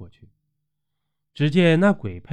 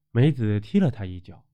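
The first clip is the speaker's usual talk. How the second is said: ordinary delivery, nothing marked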